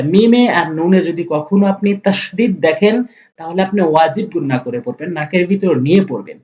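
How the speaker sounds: ordinary talk, nothing marked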